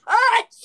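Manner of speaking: loud high achoo; sharp high sneeze. Shown as a demonstration. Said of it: "my sneezes are like this".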